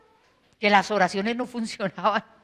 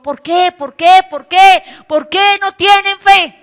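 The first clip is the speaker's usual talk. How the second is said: higher and louder repeated questions; insistent rebuke; 8 semitones higher, 9 dB louder, slower than usual. Acted. The Lord scolds the disciples, repeated loud questions. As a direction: hammer each question harder.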